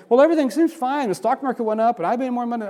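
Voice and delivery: a hint of a carefree believer. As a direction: toss it off like a self-satisfied churchgoer. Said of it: "I talk to Christians and say".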